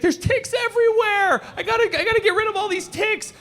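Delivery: high, frantic mock panic; panicked wife. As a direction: squeal like a wife finding ticks everywhere. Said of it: "She's like".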